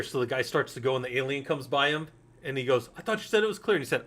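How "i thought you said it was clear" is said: high-pitched quoted complaint; mock alarmed protest. Movie character protesting as the alien comes by, mock alarm. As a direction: imitate an indignant outburst, pitched well up.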